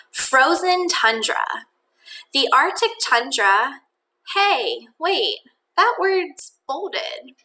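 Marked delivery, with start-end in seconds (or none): none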